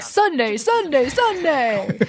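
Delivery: high, punchy announcer chant; monster truck ad. Retold or imitated. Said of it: "those monster truck ads".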